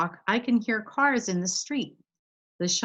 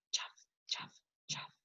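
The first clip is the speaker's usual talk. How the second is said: voiceless, breathy chuffs; soft chuffs; 17 dB quieter than usual. Retold or imitated. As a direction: puff them softly like footsteps. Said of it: "They go chuff".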